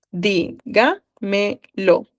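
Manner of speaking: "Dígamelo" stretched very slowly, each syllable separated. Shown as a demonstration. Teacher to class, spelling out the stress, very slow. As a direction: draw out "Dígamelo" so students hear every syllable.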